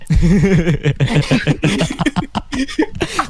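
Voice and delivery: hammed-up villain cackle; evil laugh. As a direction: laugh like a sneering panto villain. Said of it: "Ketawa jahat nih".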